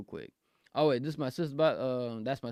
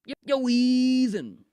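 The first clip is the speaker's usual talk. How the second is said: drawn-out high greeting; 9 semitones higher, 7 dB louder, much slower than usual. Pretend.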